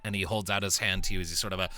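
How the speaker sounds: usual speaking voice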